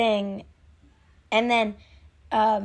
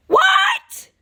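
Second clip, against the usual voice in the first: very high, loud shriek of disbelief; shocked shout; 7 dB louder than usual. Retold or imitated. Fan voicing Uzi's shock, high shrieked question.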